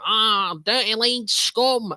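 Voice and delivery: loud, high, hammed-up Mancunian; Mancunian accent. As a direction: imitate a jeering Manc, dragging the words out. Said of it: "that is what you call a Mancunian accent".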